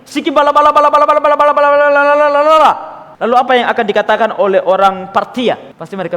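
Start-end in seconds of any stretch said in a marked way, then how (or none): 0.1-2.7 fast, higher, louder nonsense babble; preacher parodying unintelligible tongues; mock gibberish tongues. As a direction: rattle off meaningless syllables to mock tongues. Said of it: "ngomongnya seperti ini".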